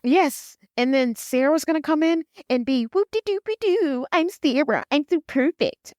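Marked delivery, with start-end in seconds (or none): 2.8-5.9 high, slow, sing-song mockery of a prissy rival; mocking perfect Sarah. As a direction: sneer it out like the perfect new woman.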